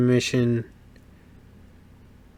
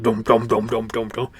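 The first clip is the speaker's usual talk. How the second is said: engine rumble imitation.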